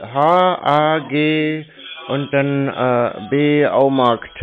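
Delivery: letter by letter, very slow; spelling out slowly. Real, not acted. Spelling the bank name for the caller, slowly. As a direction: spell it out one letter at a time. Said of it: "Können Sie bitte buchstabieren?".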